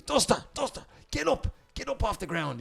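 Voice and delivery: high, urgent mock shout; half-voiced urgent yell; retold shout. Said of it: "he shouts to me".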